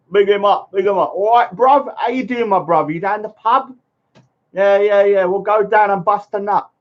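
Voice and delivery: hammed-up London Cockney, cheery and high; Cockney accent. Vlogger greeting a viewer in a mock Cockney accent. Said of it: "I'm trying to do my Cockney accent".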